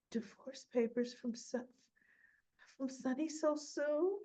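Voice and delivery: soft, rising voice of disbelief; acted dismay. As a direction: read it out softly, halting and climbing in pitch. Nina opens divorce papers, retold.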